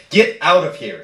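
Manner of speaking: mother snapping at her son to get out; shouted. Acted. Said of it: "she shouted".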